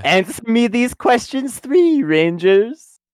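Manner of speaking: hammed-up theatrical challenge; Riddler. [name] as riddler testing the Rangers, mock-grand. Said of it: "like, the Riddler".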